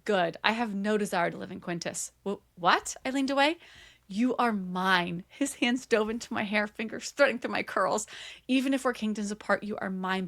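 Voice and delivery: low, intent story reading; dramatic romance reading. Acted. Reading the hero's declaration to his love, low dramatic narration. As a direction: read it low, with romantic weight.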